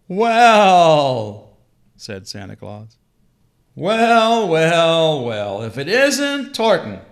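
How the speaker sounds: booming Father Christmas, amused and surprised; jolly Santa Claus. Acted.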